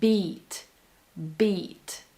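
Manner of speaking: "beat" with one kind of B, longer vowel; comparing B sounds. Shown as a demonstration. Coach comparing languages for viewers, English-style pronunciation. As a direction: say "beat" fully, vowel held.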